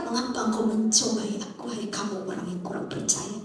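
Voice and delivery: low, soft "Mengapa engkau mencobai aku", unstressed; flat reading. Shown as a demonstration. Jesus rebuking doubters, read with flat intonation. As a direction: read "hai kamu orang yang kurang percaya" low and even. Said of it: "intonasinya datar".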